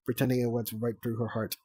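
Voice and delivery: ordinary delivery, nothing marked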